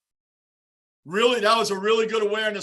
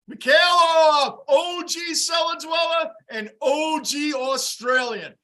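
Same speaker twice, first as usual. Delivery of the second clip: slow shouted greeting; 7 semitones higher, 3 dB louder, slower than usual. Real, not acted. Greeting chat member by name.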